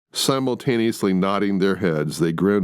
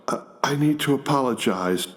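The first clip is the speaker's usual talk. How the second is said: blurted; 6 semitones higher than usual. Acted. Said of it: "Jack blurts out".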